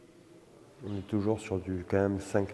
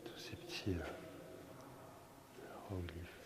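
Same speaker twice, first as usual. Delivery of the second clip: whispering; 3 semitones lower, 16 dB quieter than usual. Real, not acted. Whispering in the tomb.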